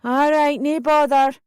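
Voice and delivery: mother calling back. A narrator's reading. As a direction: call back up the stairs. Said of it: "Mum said".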